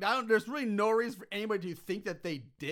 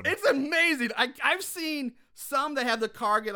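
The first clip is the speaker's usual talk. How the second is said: loud, high gushing; amazed excitement; 7 semitones higher, 6 dB louder than usual. Real, not acted. Host raves about the car explosion, thrilled. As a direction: top the co-host's praise with real awe.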